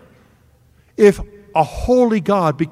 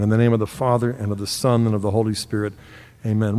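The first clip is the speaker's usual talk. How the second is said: lower, softer hushed sign of the cross; closing blessing; 8 semitones lower, 4 dB quieter, faster than usual. Acted. Preacher ending the prayer with the congregation, hushed reverent. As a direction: close the prayer gently.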